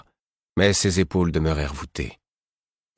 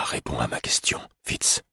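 whispered, tired plea; a soft whispered request; weary whisper; 6 semitones lower, 5 dB quieter than usual. A narrator's reading. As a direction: drop to a whisper, worn out. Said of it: "d'un ton las".

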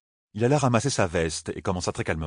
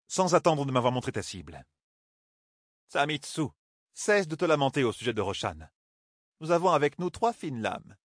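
voix aiguë, sèche et sûre; Katsuane autoritaire; 5 semitones higher than usual. A narrator's reading. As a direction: ordonne sans hausser le ton. Katsuane répond. (Katsuane commande le groupe, aiguë et autoritaire)

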